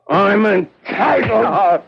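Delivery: high, breaking, tearful insistence; crying plea. Acted. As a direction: voice cracks as he begs.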